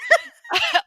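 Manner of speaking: real high-pitched laughter; high burst of laughter. Real, not acted. Cracking up at the joke.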